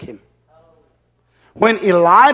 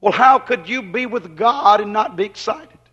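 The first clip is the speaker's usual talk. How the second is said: ordinary talk, nothing marked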